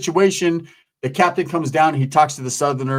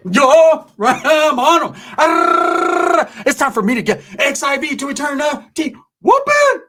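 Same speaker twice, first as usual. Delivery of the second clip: pumped up before jumping the rival; hyped battle cry; 11 semitones higher, slower than usual. Retold or imitated. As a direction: whip yourself up for the fight. Charging into the fight.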